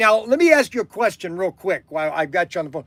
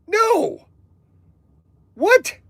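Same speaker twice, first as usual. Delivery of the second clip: shocked disbelief; 14 semitones higher than usual. Acted. Stunned disbelief at site number.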